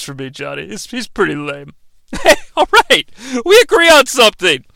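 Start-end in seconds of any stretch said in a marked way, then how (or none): none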